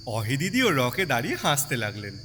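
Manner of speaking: ordinary delivery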